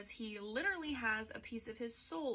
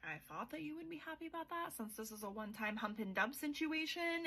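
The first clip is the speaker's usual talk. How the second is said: quick, higher, breezy heroine voice; mock Saris voice; 5 semitones higher, 4 dB quieter, faster than usual. Saris brushing off Kingfisher after their night, quick mock voice. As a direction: toss it off fast and light.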